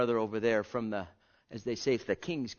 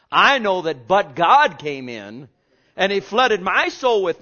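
preaching at full force; 5 semitones higher, 12 dB louder than usual. Acted. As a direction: proclaim it to the congregation.

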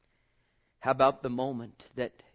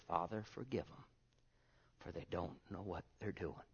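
much softer, lower voice of Jesus; tender quiet; 6 semitones lower, 14 dB quieter than usual. Retold or imitated. Preacher voicing Jesus forgiving on the cross, soft and low. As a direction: hush it like a dying plea.

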